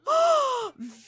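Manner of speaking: sharp high gasp of shock. Real, not acted. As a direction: gasp as the fight breaks out. Loki attacks Vidkund.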